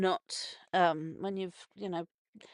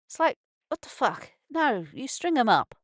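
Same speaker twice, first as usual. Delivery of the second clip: usual speaking voice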